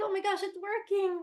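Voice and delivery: high, loud gush of delight; overjoyed that it finally works; thrilled. Retold or imitated. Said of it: "I was like".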